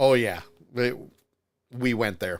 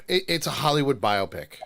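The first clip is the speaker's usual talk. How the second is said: ordinary delivery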